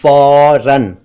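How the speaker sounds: long "aw" in फौरन; correct फौरन. Shown as a demonstration. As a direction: say फौरन as "fauran". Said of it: "फौरन या फौरन नहीं".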